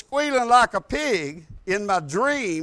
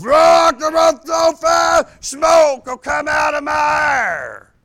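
full-force shouted demon imitation; demonic roar; 6 semitones higher, 9 dB louder, slower than usual. Possessed man boasting to the preacher, roaring demonic shout. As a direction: roar it high and loud like one possessed. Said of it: "Like that".

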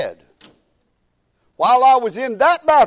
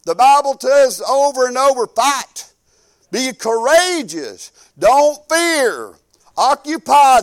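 full-force preaching, raised and driving; 4 semitones higher, 4 dB louder than usual. Acted. Preacher rousing his congregation, full-force preaching. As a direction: preach it out to the church.

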